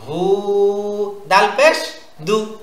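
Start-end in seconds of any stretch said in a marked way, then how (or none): 0.1-1.2 "হু" with the u held long and level; long vowel. Shown as a demonstration. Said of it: "হুতে টান আছে". 2.2-2.5 "দু" said short, with no stretch; quick short vowel. Shown as a demonstration. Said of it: "তাড়াতাড়ি".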